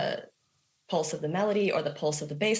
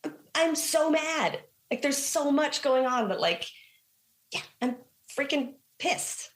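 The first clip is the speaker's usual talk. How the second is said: high, heated mock anger, slowed on the middle line; reliving the frustration, voice pushed up high; half-acted fury at the teacher; pissed off; 9 semitones higher than usual. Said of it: "You sound angry".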